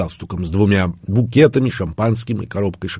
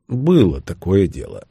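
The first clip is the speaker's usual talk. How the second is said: ordinary delivery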